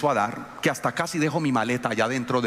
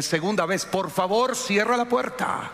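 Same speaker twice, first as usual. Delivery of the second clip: rising, slower, higher demand; second warning; 7 semitones higher than usual. Retold or imitated. Pastor retells ordering daughter to close door, rising and stern. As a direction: press the order harder. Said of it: "Le dije".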